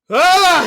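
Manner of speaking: short high mock scream; sudden high-pitched yelp, imitated; retold yell. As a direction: let out a high, comic "Ah!". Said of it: "he, like, yells and walks out".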